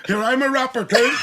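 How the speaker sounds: high, laughing line; laughing high quote. Retold or imitated. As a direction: blurt it while laughing.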